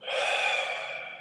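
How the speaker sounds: long soft exhale; airy, unvoiced sigh; real sigh. Real, not acted. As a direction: let out a long, soft breath. Settling before the meditation.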